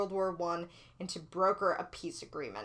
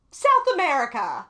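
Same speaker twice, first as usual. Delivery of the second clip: big announcement; 4 semitones higher, 8 dB louder than usual. Acted. Announcing the next topic.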